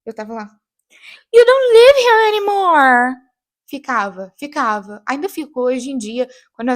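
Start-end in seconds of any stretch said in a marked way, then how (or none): 1.3-3.1 hammed-up, drawn-out high shout; mimicking the character. Repeating a series line at home, theatrical shout. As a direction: belt it out like an overblown TV drama. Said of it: "repetia exatamente o que a personagem tava falando".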